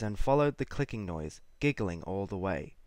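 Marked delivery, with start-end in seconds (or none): none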